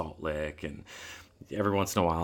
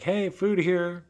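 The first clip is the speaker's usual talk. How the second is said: high, slow mock call to tigers; 13 semitones higher, 5 dB louder, much slower than usual. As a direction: call out slowly like bait. Calling tigers to come eat.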